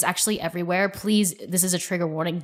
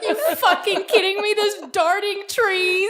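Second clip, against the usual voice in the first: high, laughing mock panic; freaked-out husband; 13 semitones higher, 3 dB louder, much slower than usual. Wife imitating husband at the trees, freaked out. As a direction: squeal in disbelief, drawing out the last words. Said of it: "Sean's, like, really freaked out".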